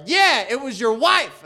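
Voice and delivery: loud, high, drawn-out taunt; mock yelled burn, slow and high; imitated heckler comeback. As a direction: throw it back like a cocky kid. Acting the kid's heckling comeback.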